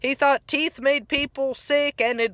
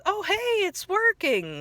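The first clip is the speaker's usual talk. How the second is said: high, softer cheerful cartoon voice; a pleased cartoon sponge, pitched up; SpongeBob imitated; 8 semitones higher, 3 dB quieter than usual. SpongeBob quote, retold episode.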